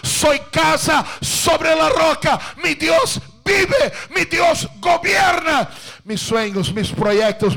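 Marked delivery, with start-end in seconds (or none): 0.0-5.7 higher, louder proclaiming; preacher leads the faithful in a loud shouted confession; shouted declaration. Shown as a demonstration. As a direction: cry it out like a victory.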